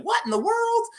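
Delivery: very high, sheltered kid imitation; mock wholesome cussing. Sheltered kid cussing in Fortnite, mock wholesome outburst. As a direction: do a Southern kid's squeaky-clean outburst. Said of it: "he was like Ned Flanders".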